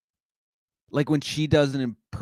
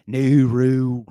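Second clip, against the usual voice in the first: loud, drawn-out mock-Irish host voice; Bill Maher with an Irish accent; 3 semitones higher, 7 dB louder, much slower than usual. Comic trying out an Irish talk-show host, slow loud Irish accent. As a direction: announce it slowly and loudly in an Irish brogue. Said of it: "Irish Bill Maher would be a great character".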